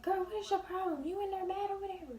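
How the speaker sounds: high, loud nagging mom voice; mom demanding. Retold or imitated. Mom asking her sulking daughter what her problem is, high nagging. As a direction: call through the door as the irritated mom.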